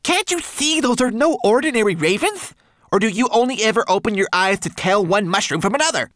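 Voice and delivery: high, loud, exasperated little creature; desperate outburst. A narrator's reading. Homunculus warns brownie about ravens, desperate. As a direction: berate her in a high, frantic voice. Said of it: "cried Twigleg desperately".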